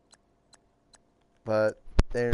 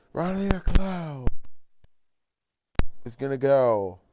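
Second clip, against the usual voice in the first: broadcast style; 2 semitones higher, 4 dB louder than usual. Pretend. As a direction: call it like a TV announcer, building to the interception. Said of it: "I'm gonna do this broadcast style".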